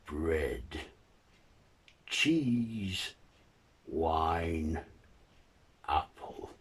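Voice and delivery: one slow word at a time, drawn out; halting monster voice with long gaps; creature naming things. Acted. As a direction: creature sounding out newly learned words. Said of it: "the creature comes out from hiding and looks and says".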